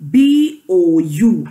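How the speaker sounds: "B O U" in separate, measured letters; spelled out. Shown as a demonstration. Teacher drilling the class in spelling, "B O U" letter by letter. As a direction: chant "B O U" one letter per beat. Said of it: "Say with me".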